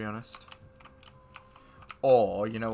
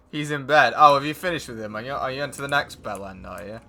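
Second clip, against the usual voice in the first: ordinary talk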